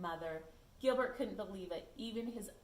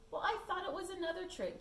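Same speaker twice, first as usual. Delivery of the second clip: higher complaining voice; lightly voiced groan; 9 semitones higher than usual. Gilbert complaining after the kickball, higher, aggrieved. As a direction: grumble while rubbing a sore head. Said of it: "Gilbert groaned".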